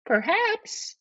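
very high falsetto; high-pitched, tentative wife; suggesting wife. Acted. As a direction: suggest it in a piping wife's voice.

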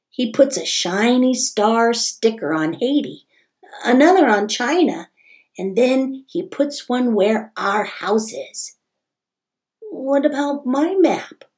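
bright, lively storybook reading; reading to children. A narrator's reading. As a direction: read it like story time for kids. Reads picture book aloud.

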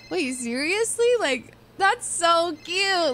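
high, drawn-out mock cooing; gushing cooing. Friends fussing over a tearful pal, high gushing coo. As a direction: coo over it, stretching the words.

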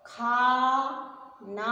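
खाना very slowly, both आ sounds held wide open; open long vowels. Shown as a demonstration. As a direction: stretch खा and ना apart, mouth fully open. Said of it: "आ की मात्रा में पूरा मुंह खुलेगा". (खाना को मुंह खोलकर, खींचकर, धीरे बोलना)

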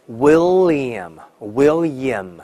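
William slow as WIL-liam, dark L held; double L. Shown as a demonstration. Teacher says own name as example, slow dark L. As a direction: stretch the L in William. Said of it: "这种感觉啊".

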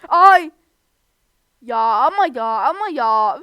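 slow, loud drawn-out complaint; exasperated whine; loud frustrated wail. Real, not acted. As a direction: groan it out after failing the jump. Falls in the game.

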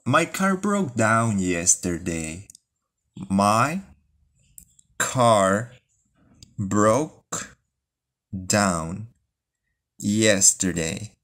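ordinary talk

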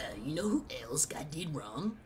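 raspy put-on cartoon voice; hammed-up cartoon bro question; Muscle Man. Shown as a demonstration. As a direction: set up the running joke, cartoon style. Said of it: "Muscle Man impression".

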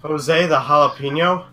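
"Jose the Jalapeno" with H sounds for the J; Spanish-style pronunciation. Real, not acted. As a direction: say "Jalapeno" as ha-la-peen-yo, questioning. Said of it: "That's English Spanish".

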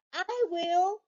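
high mock kid's yell; high child's eager yell; Pete yelling. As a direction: shout it like an eager boy. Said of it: "Pete yelled".

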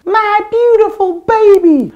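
a very high, loud shriek of horror. Acted. Mother shrieking as the Blob swallows her baby.

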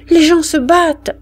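the mother stammers to the soldiers; stammering mother. Acted. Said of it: "balbutia la femme". (mère qui balbutie, voix plus aiguë)

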